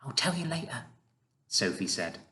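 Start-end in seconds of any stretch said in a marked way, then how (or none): 0.0-0.9 put-on soft, higher girl's whisper. A narrator's reading. Sophie putting off the BFG, whispered. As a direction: whisper it back quickly and quietly. Sophie hushed beside sleeping giants.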